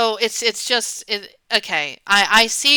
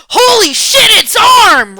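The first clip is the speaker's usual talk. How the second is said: much higher and louder, a shocked yelp; shocked outburst; 12 semitones higher, 13 dB louder, slower than usual. Shown as a demonstration. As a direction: blurt it out in total shock. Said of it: "You wouldn't do that".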